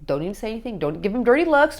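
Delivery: normal talking voice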